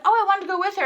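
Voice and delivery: high, loud retold plea; acted protest. Fan pleading with security to join her friend, high protest. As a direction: pipe up in eager protest.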